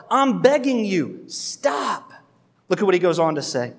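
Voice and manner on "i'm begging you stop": slow, heartfelt begging; earnest plea. Retold or imitated. Paul begging the Galatians to stop, earnest. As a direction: plead with rising urgency.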